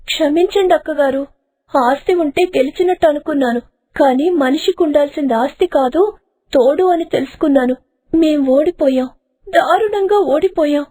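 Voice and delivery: apologise, ashamed; remorseful wife. Acted. Kantamma repents.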